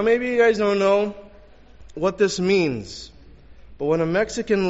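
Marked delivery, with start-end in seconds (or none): none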